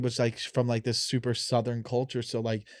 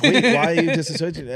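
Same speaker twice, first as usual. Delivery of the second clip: mock baffled; 5 semitones higher, 8 dB louder than usual. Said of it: "I'm like".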